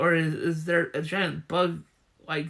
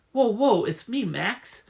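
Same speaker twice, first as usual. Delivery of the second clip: ordinary talk, nothing marked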